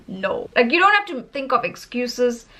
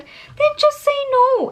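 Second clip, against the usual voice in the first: very high, drawn-out exhortation; high-pitched, stretched-out encouragement; playful urging; 14 semitones higher, 4 dB louder than usual. Real, not acted. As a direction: urge it slowly, voice pitched way up. Playfully urging viewers to refuse.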